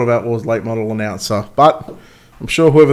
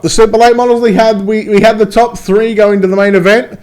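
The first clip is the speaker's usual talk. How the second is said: race announcer; 6 semitones higher, 3 dB louder than usual. Acted. Announcer voice again.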